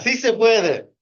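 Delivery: high, loud Sí se puede chant; rally cry. Real, not acted. Speaker rallying the audience, loud high chant. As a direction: shout it like a crowd chant.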